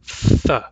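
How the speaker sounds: Th hissed with the tongue tip between the teeth; th sound demo. Shown as a demonstration. Teacher repeating theta for students, firm hiss. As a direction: push the Th out with force. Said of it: "which we write as T H".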